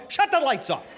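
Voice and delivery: exasperated demand. Acted. Pulled-over driver to police, exasperated demand. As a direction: snap it like you've had enough.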